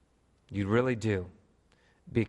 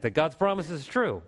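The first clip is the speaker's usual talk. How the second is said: ordinary delivery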